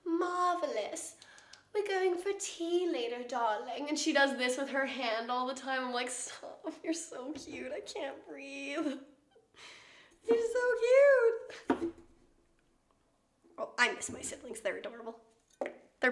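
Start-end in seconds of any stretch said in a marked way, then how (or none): 0.0-3.9 slow, high, posh British drawl; imitated British accent. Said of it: "she talks with a British accent". 6.1-11.9 slow, breathless gush building to a high squeal; overwhelmed gushing. Retold or imitated. Gushing over her adorable sister.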